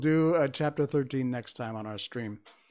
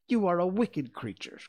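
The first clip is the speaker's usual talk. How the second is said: put-on higher-pitched girl's accusation; indignant cry; 6 semitones higher than usual. A narrator's reading. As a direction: cry out in mild outrage. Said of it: "cried Dorothy".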